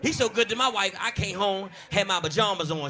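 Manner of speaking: ordinary talk, nothing marked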